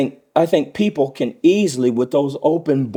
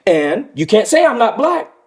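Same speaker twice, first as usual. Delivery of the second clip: high, louder mock politician reasoning aloud; imagined Miss Harris; 8 semitones higher, 3 dB louder than usual. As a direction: argue it high and insistent, in someone else's role. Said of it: "that allows Miss Harris to say".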